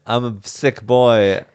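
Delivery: usual speaking voice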